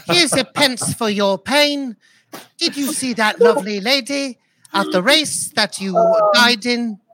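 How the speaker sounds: playful Cockney street talk; Cockney accent. Pretend. Cockney bloke voice in proposed scene.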